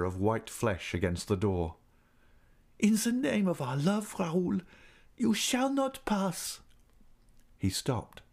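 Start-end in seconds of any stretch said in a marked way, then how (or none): 2.8-6.6 very high, dramatic woman's voice; high-pitched tragic heroine; impassioned plea. A narrator's reading. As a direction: forbid him passionately, slowing at the end. Woman desperately bars the door.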